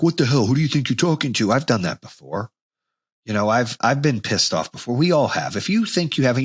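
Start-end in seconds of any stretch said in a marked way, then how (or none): 0.0-1.5 retold angry snap. His past self snapping at someone, mock angry. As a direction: bark it back like your ego took over. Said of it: "where I've used my ego".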